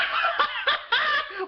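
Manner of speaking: high-pitched joyous laughing; acted giddy laugh; elated laugh. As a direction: burst into overjoyed laughter. George realises he lives.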